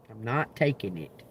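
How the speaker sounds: ordinary talk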